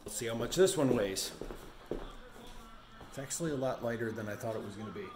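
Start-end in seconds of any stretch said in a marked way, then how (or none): none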